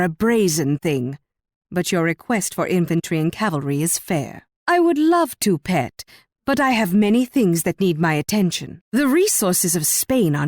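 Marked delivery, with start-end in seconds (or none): 4.7-6.0 high-pitched coaxing, dropping on pet; coy condescension. Acted. Queen answering her pet's request, coy condescension. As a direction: indulge them coyly, then let it fall.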